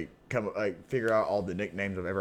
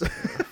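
giggling. Real, not acted. Laughing at groundhog news.

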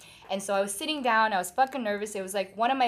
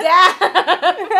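loud, high-pitched cry of amazement; amazed reaction; 9 semitones higher, 15 dB louder than usual. Retold or imitated. Reacting to a friend's accent, loud amazed outburst. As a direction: blurt it out very loud, breaking into laughter. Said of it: "And I'm like".

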